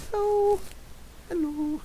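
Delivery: very high, drawn-out hello; cutesy pet greeting. Pretend. Saying hi to everybody with Bagel, cutesy squeak. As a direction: squeak a long, cute greeting.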